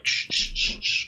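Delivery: repeated voiceless shushing hisses; imitated stabbing noise. Player acting out stabbing a foe, hissed stab sounds. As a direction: hiss out each stab.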